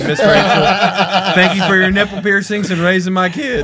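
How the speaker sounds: laughing. Real, not acted. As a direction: drag it out through laughs. Joking thanks, laughing.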